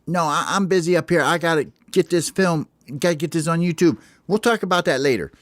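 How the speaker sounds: too busy filming to talk; busy Smalls. Acted. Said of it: "Smalls says".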